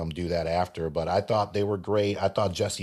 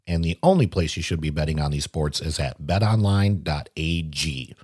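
louder, punchy sponsor ad read; 5 dB louder than usual. Acted. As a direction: sell the betting site like a radio spot. Reads a sponsor ad.

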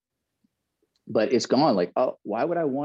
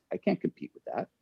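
usual speaking voice

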